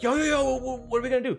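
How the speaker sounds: panicked. Retold or imitated. Kids panicking about the driver.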